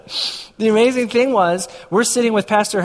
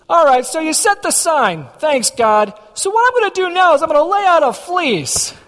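much higher, put-on wheedling with God; mock bargaining prayer; 8 semitones higher, 3 dB louder than usual. Asking God for one more sign, mock bargaining. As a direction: lift the voice high, haggling in mock prayer. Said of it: "we do the Gideon dance".

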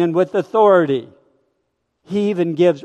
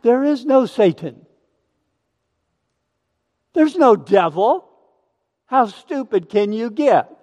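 mocking skeptic; 5 semitones higher than usual.

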